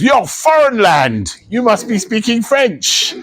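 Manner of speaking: slow, high, mock-pompous inner voice; hammed-up voice of his own brain; brain's inner voice. Brain insisting abroad means French, slow and high. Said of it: "It goes".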